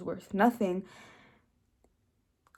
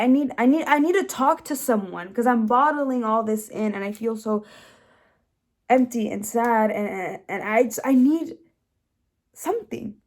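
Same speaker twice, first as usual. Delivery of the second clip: desperate plea; 2 semitones higher, 6 dB louder than usual. Retold or imitated. Student retelling hitting rock bottom, desperate. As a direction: plead as if at breaking point. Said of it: "I'm hitting rock bottom".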